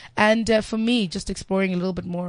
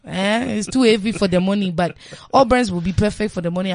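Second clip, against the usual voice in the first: Nigerian Pidgin; 4 dB louder than usual. Pretend. Asked about breakfast, answering in Nigerian Pidgin. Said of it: "can you answer in, like, Pidgin".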